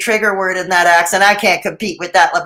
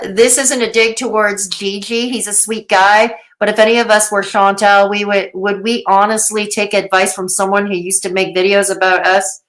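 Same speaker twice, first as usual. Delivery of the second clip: ordinary talk, nothing marked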